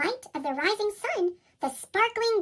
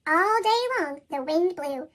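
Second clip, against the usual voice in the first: ordinary delivery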